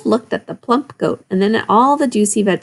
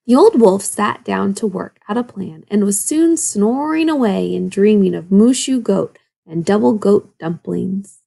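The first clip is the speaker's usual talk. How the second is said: ordinary delivery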